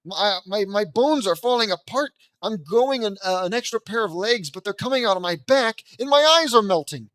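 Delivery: loud, high, hammed-up panic as body falls apart; overacted cartoon horror, shouting it out; a silly kid melting away in mock terror; mock panicked mutant. Cartoon kid mocks radiation mutation.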